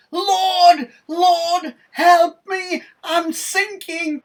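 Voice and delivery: very high, loud cry for help; panicked scream. A narrator's reading. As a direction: scream out as if drowning. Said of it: "So he screams".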